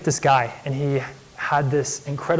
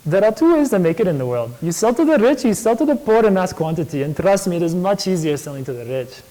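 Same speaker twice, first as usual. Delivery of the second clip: put-on higher, animated Indian accent; put-on Indian accent, pitched up then settling; 3 semitones higher than usual. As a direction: imitate an Indian businessman giving advice. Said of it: "I'll try to do the voice".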